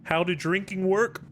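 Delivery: hoarse, straining to talk after choking; strained after choking. Real, not acted. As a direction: fight through a choke. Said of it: "I just choked".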